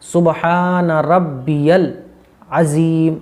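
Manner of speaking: रब्बियल very slow with a clear यल ending; slow, clear Rabbiyal. Shown as a demonstration. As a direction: draw out रब्बियल so the 'ya' is heard. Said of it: "या लाम ज़बर यल". (रब्बियल धीरे, 'यल' साफ़ बोलकर)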